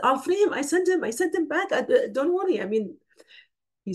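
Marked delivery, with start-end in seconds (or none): 0.0-2.6 high, eager rush to free him; eager offer. Acted. Captor Khurash to the Prophet, eagerly offering to free the man. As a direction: jump in keen to let him go.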